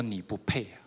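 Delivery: low, hushed imitation of God speaking; retold inner voice. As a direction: say it softly, a quiet verdict from within. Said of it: "在我心里面说".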